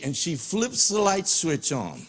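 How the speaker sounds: usual speaking voice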